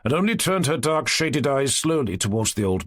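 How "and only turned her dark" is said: ordinary delivery, nothing marked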